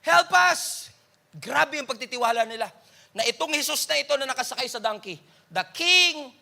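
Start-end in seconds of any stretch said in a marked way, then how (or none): 0.0-0.9 shouted high and loud, Help us!; crowd shouting. Retold or imitated. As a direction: yell it like a desperate crowd. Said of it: "Sumisigaw sila".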